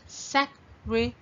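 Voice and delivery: "secretary" in four separate beats; broken into syllables. Shown as a demonstration. Teacher giving the answer, "secretary" split into syllables. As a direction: pause after each syllable of "secretary". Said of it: "find out how many syllables in it".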